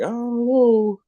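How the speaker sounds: jumps way up, scared and unsure; mock-scared high whine; high panicky squeak. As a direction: squeak it out like a frightened kid. Retold fear at nurse.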